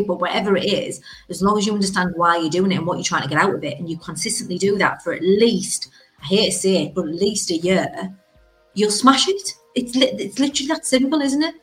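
ordinary delivery

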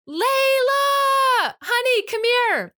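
high, drawn-out holler upstairs; yelled name call. Retold or imitated. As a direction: call the child over, high and sing-out. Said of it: "I yell her name".

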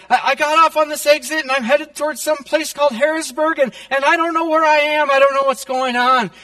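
imitated panicky aunt, high and loud; desperate. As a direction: voice a lost caller in a high fluster. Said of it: "And she's desperate".

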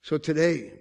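normal talking voice

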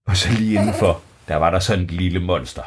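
ordinary delivery

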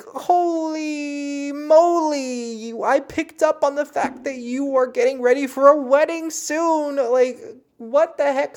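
high and loud, stretched in disbelief; amazed. Retold or imitated. Psychic marveling at the spirit's accuracy, high and amazed. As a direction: gush in wide-eyed astonishment.

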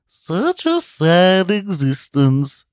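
very slow, high, eerie pity; sinister rice maker. Acted. Rice maker mocking Zealot, slow eerie voice. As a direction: drawl it out like a gloating machine.

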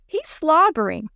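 high, grossed-out exclamation; disgusted squeal. A narrator's reading. Lori about the slobbering dog, disgusted squeal. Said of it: "Lori squealed".

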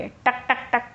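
crisp little tock sounds, beak on a foot; pecking taps. Retold or imitated. Bird pecking the sleeping rabbit's foot, crisp taps.

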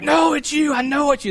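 high, loud eager insistence; excited teens won't take no; insistent fans. Retold or imitated. Teen fans insist to pastor, high and loud.

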